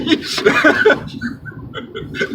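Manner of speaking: squealing fangirl giggle; high giggle. Acted. Giggle after fangirl bit.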